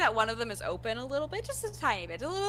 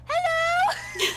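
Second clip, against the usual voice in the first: excited greeting; 6 dB louder than usual. Acted. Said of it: "I'm like".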